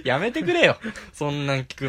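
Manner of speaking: laughing loud protest. Real, not acted. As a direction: laugh through it. Laughing at friend's story.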